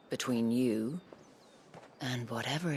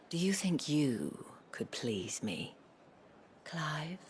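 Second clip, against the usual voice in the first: breathy, teasing invitation; seductive whisper; 4 semitones higher, slower than usual. Acted. Isabel teasing Clive, breathy and seductive. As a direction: draw him in with a hush.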